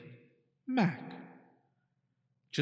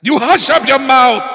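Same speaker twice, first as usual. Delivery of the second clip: much higher, very loud howl; howled order; 10 semitones higher, 16 dB louder than usual. A narrator's reading. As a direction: howl him into silence. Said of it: "howled the mighty King Yertle".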